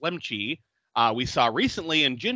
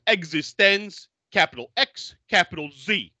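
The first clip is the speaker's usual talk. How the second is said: higher, lightly mocked Boston-ish accent; a hint of confused put-on accent; mock muddled accent; 5 semitones higher, 3 dB louder than usual. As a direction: imitate a botched Northern England-New York accent. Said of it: "I don't know what accent he was trying to, to put on".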